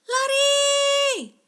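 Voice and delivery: high, loud cry to run; urgent shout. A narrator's reading. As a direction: yell a warning to flee. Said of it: "seru Yaki". (teriakan panik yang tinggi)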